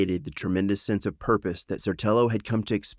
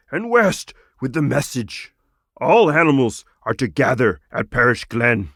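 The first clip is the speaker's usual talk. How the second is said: louder, ringing higher call to the flock; rousing frog commander; 4 semitones higher, 6 dB louder than usual. Acted. Frog rallies the sparrows, loud and rousing. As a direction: send them off like a general.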